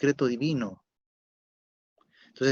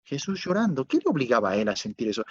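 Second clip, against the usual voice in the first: ordinary talk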